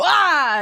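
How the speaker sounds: sudden loud "Ah!"; jump-scare yell. Acted. A friend springing a scare at the wrong moment, jump-scare yell.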